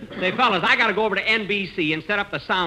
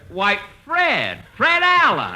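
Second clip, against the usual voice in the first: rising, louder cry of surprise on the name; surprised recognition; 7 semitones higher, 6 dB louder, much slower than usual. Acted. Jack on the bus, recognising Fred Allen, loud and surprised. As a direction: spot an old rival and blurt his name.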